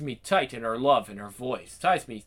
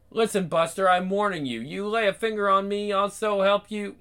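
gangster's moll; 8 semitones higher than usual. Acted. Tough woman threatens stranger.

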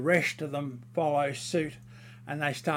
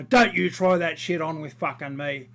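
retold angry warning; 8 dB louder than usual. Retold confrontation in the meeting.

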